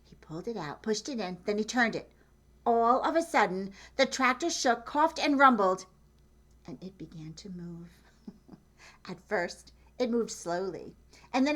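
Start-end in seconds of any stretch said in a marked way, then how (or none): 2.6-5.9 louder and higher as the tractor shakes; building excitement. A narrator's reading. Narrating the tractor roaring to life, rising and louder. 6.7-7.9 hushed. A narrator's reading. Tractor starts moving.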